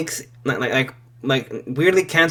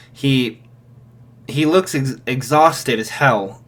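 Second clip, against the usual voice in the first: ordinary talk, nothing marked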